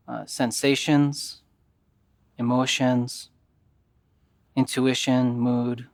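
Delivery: calm, even yoga nidra guidance with long pauses; guided meditation. Acted.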